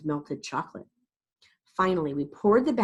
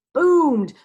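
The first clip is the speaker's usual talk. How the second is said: booming thunder; 8 semitones higher, 10 dB louder than usual. A narrator's reading. Thunder sound effect.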